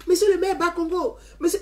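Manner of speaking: a lighter high chant of the mayor's title; children calling out. Retold or imitated. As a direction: echo the kids calling him again.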